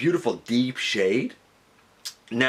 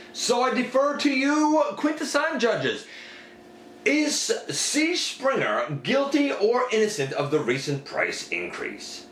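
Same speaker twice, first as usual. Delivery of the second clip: mock courtroom address; 10 semitones higher than usual. Reviewer puts Springer's case to the Quintesson judges, mock-courtroom address. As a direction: put the case grandly to the judges, voice raised in pitch.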